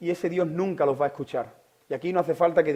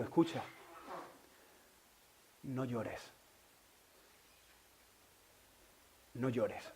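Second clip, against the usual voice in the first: much softer, hushed comfort; soft whisper of comfort; 5 semitones lower, 15 dB quieter, slower than usual. Acted. The Lord whispering to a crying listener, hushed. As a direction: hush it like a secret to the ear. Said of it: "te quiere susurrar en el oído".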